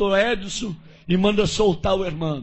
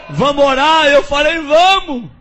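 eager; 6 semitones higher, 6 dB louder than usual. Retold or imitated. Eager invitation to pray.

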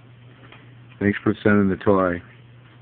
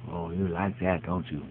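soft coo; 2 semitones lower, 10 dB quieter, slower than usual. Real, not acted. Soft cooing to dog.

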